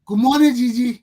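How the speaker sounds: loud, high greeting down the line; calling out. Real, not acted. Host calling a guest on the phone line, loud greeting. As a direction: call out to be heard on a bad line.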